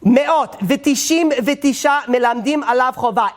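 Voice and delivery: slow, high chanted Hebrew; drawn-out Talmud chant; Hebrew recitation. Shown as a demonstration. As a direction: intone the Hebrew text slowly, like study-hall chant. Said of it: "You should listen in Hebrew".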